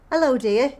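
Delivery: a touch of a posh telephone voice. Real, not acted. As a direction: sweeten it up for the person on the line. Greets the call operator.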